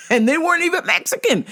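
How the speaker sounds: high, laughing exclamation. Real, not acted. Amazed aside about the clients, laughing. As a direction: say it through a real laugh.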